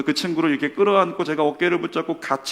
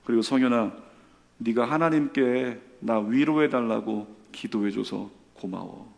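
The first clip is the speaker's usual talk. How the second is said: slower, lower, tender thanks; calmed-down gratitude; 4 semitones lower than usual. Retold or imitated. Friend done crying thanks his companion, tender and subdued. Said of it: "울음을 그친 그 친구가".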